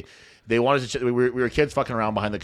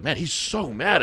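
raised-pitch mild astonishment; retold surprise; 5 semitones higher, much slower than usual. Son recalling how mad dad got, surprised. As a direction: replay your own disbelief, voice lifted. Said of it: "I was surprised".

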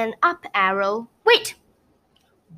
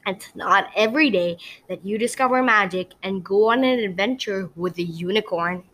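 ordinary delivery, nothing marked

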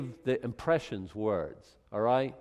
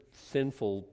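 normal talking voice

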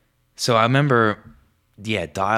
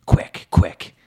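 urgent whisper; 5 dB louder than usual. Retold or imitated. Kid hurrying secretly.

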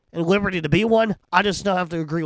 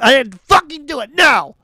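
higher, louder blustering denial; Donald Trump; 7 semitones higher, 5 dB louder than usual. Retold or imitated. Host mocks a denial as Trump, blustering. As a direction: deny it like a brash politician. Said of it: "Like Donald Trump".

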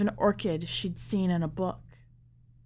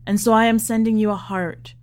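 put-on low, tender old father speaking to his daughter; old father's message to his faraway daughter, tender and low; father's farewell message; 3 semitones lower than usual.

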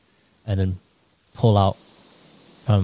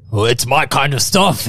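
lightly voiced Grunghill; 5 semitones higher, 5 dB louder than usual.

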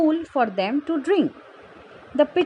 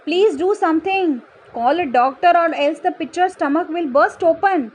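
higher, urgent plea; begging aunt; 5 semitones higher, 4 dB louder than usual. A narrator's reading. Sweetie Aunt begs others to help pitcher, urgent begging. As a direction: plead for help in a raised pitch. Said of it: "she begged".

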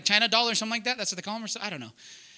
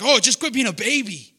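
a louder, scoffing put-down; mock the wife as a rude husband would; dismissive husband; 6 dB louder than usual. Mocking what a husband shouldn't say.

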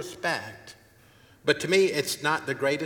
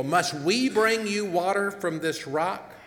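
higher, slow, self-important question; proud Moses; 7 semitones higher, 4 dB louder than usual. Retold or imitated. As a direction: take the credit for the miracle. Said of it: "when he said to the people".

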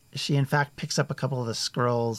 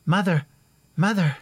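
high, loud urgent call; breathless messenger calling; 7 semitones higher, 8 dB louder than usual. Acted. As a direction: call out to her as you run in. Young man runs in calling.

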